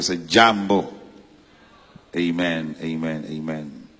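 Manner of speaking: ordinary talk, nothing marked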